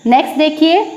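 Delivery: ordinary delivery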